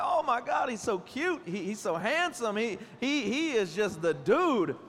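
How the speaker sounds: put-on high, gushing over a cute kid; gushing admirer. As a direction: coo like you're melting over a baby. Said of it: "be like".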